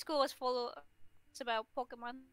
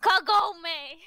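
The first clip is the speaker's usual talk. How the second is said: loud, playful call back; re-enacted hallway shout; retold answering yell; 4 semitones higher, 8 dB louder than usual. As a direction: holler the name back, raised pitch. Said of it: "she will yell to me back".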